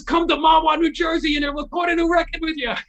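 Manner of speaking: eager fan passing on a message; excited. Retold or imitated. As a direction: blurt the news in excitement. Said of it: "I'm, like, so excited".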